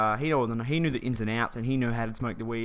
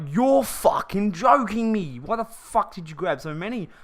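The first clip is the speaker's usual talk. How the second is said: higher, louder, slow disbelieving outburst; imitating an annoyed mate, slow and loud; mock outraged mate; 5 semitones higher, 4 dB louder, slower than usual. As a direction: play the mate blowing up at his friend. Mate scolds him over cigarettes.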